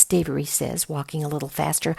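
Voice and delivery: plain reading voice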